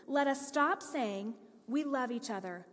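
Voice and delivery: ordinary delivery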